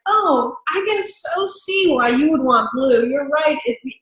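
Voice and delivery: cheerful rep turning down the blue request; high enthusiasm. Shown as a demonstration. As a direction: delight even while saying no. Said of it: "Then you want it to be like".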